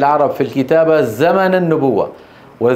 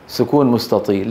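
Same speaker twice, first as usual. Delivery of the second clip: ordinary delivery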